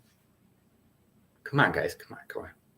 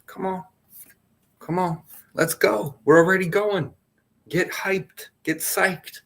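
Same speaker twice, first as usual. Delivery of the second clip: higher-pitched hammed-up pep talk; mock hype coach; 7 semitones higher than usual. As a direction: pump them up in a cartoonish coach voice. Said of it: "I'm not gonna then say, like".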